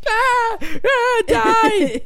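very high-pitched battle scream; mock attack scream. Kid stabbing the bully with a pencil, mock attack scream. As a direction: shriek it in a hammed-up frenzy.

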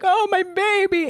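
high, slow mock wail; hammed-up distraught mother; dramatic soap-opera mother. Soap-opera mother running to her daughter, hammed-up high wail. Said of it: "she's like".

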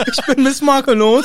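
hoch und lauter, nervig; nervige Stimme. Pretend. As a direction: als Miss Makellos nerven. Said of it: "Ich rede jetzt sehr nervig". (hoch und nervig gesprochen)

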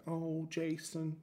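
sympathetic American radio host, lightly done; mock American radio host. Radio host soothing the caller, mild American accent. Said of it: "I don't know why everyone is American".

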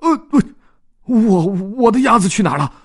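high, stammering panic; a lost owner stuttering in alarm; panicked. Acted. Dodds realizing his duck is gone, panicked and stammering. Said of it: "他顿时惊慌失措".

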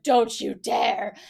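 slow, heavy warning; fired up. Retold or imitated. Russell warning Parvati not to talk to Danielle, slow menacing. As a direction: lean on each word like a threat. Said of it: "he gets so fired up".

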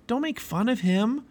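put-on high-pitched boy's objection; pliable boy. A narrator's reading. Pliable telling Obstinate off, protesting.

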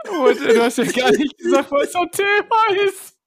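crying with laughter, high; words pushed through a laughing fit. Real, not acted. Laughing fit during intro. (unter Tränen lachend)

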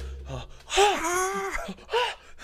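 high, loud gasping shriek; mock terrified gasp. Acting out someone terrified in bed, high mock gasp. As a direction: gasp in exaggerated terror. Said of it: "you're just screaming".

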